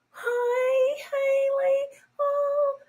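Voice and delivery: high breathy put-on baby voice; mock the sugary sweet woman; fake sweet voice. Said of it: "I'm always leery of women that".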